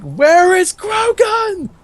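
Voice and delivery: high, slow, silly calling out; mock high call. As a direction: stretch it up high like a lost giant. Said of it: "it's like".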